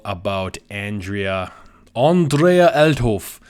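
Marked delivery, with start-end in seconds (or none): 1.9-3.4 the name drawn out slow in a louder, higher mock German accent. Vlogger naming the German head brewer in a German accent. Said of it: "I don't know why I'm saying it in that accent".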